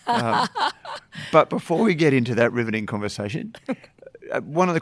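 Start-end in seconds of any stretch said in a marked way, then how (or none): 0.5-1.3 short high-pitched burst of laughter. Real, not acted. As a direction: break into a high giggle. Host laughs at dull title.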